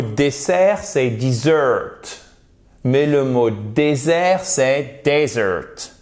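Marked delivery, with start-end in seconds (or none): 1.1-2.0 dessert as dih-ZURT, drawn out slowly; dessert with short I, then a long stressed ending. Shown as a demonstration. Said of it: "le E se prononce comme un I court". 5.0-5.9 desert as DEZ-ert, drawn out slowly; first-syllable stress. Shown as a demonstration. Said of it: "vous avez l'accentuation sur la première syllabe".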